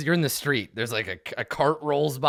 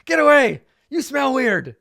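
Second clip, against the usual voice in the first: very high, loud yapping voice; barking guard dogs; 13 semitones higher, 12 dB louder than usual. Acted. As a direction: shout each phrase like an angry bark. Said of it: "They start barking again, and they're like".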